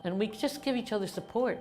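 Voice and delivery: ordinary talk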